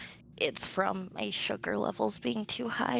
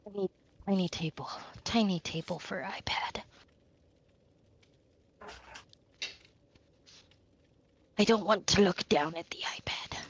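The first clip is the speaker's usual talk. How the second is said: sleepy whisper. Real, not acted. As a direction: breathe the words, half asleep.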